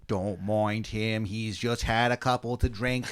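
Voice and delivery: slow, hammed-up fake Boston accent; awful Boston accent. Colonist excusing you to the redcoat, bad Boston accent. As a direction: lay on a terrible Boston accent. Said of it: "easily the worst Boston accents".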